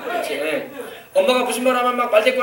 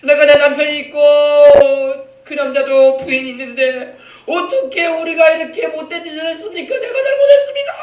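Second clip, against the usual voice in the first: mock-sobbing plea, rising higher to the end; imitated tearful repentance; 5 semitones higher, 5 dB louder than usual. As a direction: act out a sinner wailing for forgiveness. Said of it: "막 눈물이 막 흐르면서 막 왔을 거예요".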